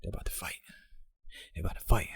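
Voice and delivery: very soft, near-whispered aside; hushed anticipation. Retold or imitated. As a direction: murmur it like a spectator to himself. Watching the video, fight about to start.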